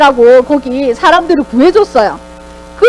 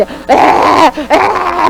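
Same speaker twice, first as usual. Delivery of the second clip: very slow, stretched idiot's "에, 에"; drawn-out madman's groan; much slower than usual. Retold or imitated. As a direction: play the fool, dragging out each "에". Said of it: "정말 얼마나 연기를 잘했는지". (미친 척 길게 늘이는 "에, 에")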